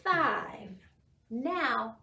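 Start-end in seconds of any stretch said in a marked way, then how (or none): 0.0-0.7 slow, drawn-out counting for little ones; teacher counting umbrellas with toddlers, slow and clear; counting with children. Shown as a demonstration. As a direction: count each number out for the kids to echo. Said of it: "help me count these".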